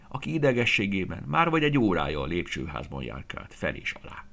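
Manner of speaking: ordinary delivery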